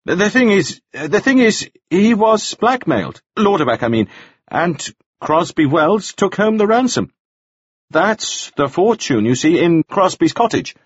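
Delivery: put-on high, hesitant, stammering young man's voice; a flustered man spilling a secret; nervous Nielsen. A narrator's reading. Nielsen confessing the blackmail to the jailer, high and stammering.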